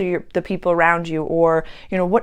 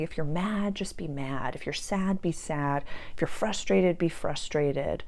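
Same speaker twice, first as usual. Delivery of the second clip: soft hushed quote; 8 dB quieter than usual. Retold or imitated. Quoting friend's advice softly.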